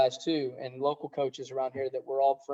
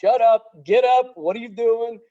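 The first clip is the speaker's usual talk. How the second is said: imitated yell, higher and louder, slowed; mock tough-love yell; 8 semitones higher, 8 dB louder than usual. Assistant coach Andy yelling at a moping head coach, imitated yell. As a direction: bark it like a coach kicking you in the ass. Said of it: "he was like".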